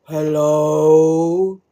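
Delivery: low, drawn-out ghostly hello; ghost man's hello. Retold or imitated. Unseen man answers from the empty kitchen, low and eerie.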